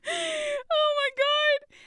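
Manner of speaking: high drawn-out laughing wail; exasperated, almost crying with laughter; slow high laughing moan; laughing groan. Real, not acted. Exasperated about family ADHD.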